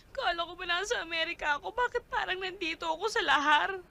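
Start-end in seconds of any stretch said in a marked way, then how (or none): none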